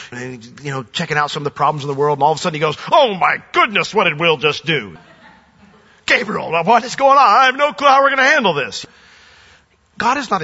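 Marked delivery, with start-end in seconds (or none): 2.9-8.9 higher, louder flustered alarm; lightly voiced mock panic calling to Gabriel; mock panicking God. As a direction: ham up a God who's lost control.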